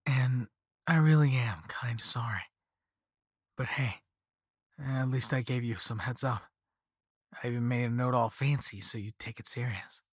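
mild rueful apology; thin, watery voice. A narrator's reading. Caller says sorry for passing on curse, thin watery voice. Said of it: "His voice got thin, a watery sounding".

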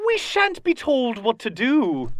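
high, slow creature voice; defiant Kenku. Acted. Kenku answering a warning about danger, high defiant voice. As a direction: refuse slowly in a high put-on voice.

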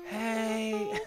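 a light imitation of a groggy hello; sleepy morning voice. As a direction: croak a sleepy hey. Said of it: "a friend's voice in the morning".

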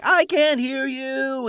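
high, slow, drawn-out yell; yelled back. Retold or imitated. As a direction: bellow it down slowly from far above. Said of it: "he yelled back".

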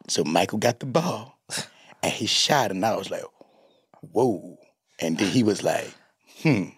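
mock Magic describing a play; lightly voiced awestruck Magic Johnson; Magic Johnson impression. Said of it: "Magic delivered everything like".

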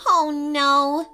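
high storybook squeal; high-pitched acted dismay. A narrator's reading. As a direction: squeal it in mild panic. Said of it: "squealed Soraya".

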